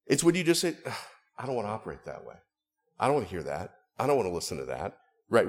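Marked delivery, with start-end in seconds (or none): 0.9-5.0 low, softened scornful refusal; dismissive distaste. Acted. Preacher acting someone who won't listen to God, dismissive. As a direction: turn your nose up and brush it off. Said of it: "turning up your nose at something".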